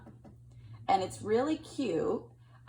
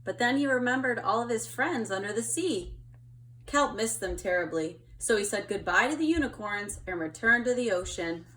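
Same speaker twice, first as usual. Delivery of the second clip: put-on gentle storytelling read to kids; picture book reading. A narrator's reading. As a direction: read it like to a child at bedtime.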